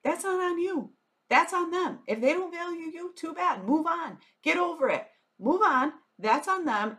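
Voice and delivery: high-pitched, emphatic pep talk to a client; blunt tough-love advice. Acted. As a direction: tell them firmly to let it go. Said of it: "you would look at them and say".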